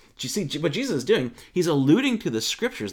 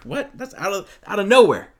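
high-pitched mock surprise, landing loud on nowhere; baffled reaction; 7 semitones higher, 8 dB louder than usual. Listeners reacting to an unexpected answer, baffled. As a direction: act out a thrown listener.

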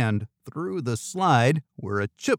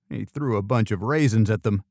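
usual speaking voice

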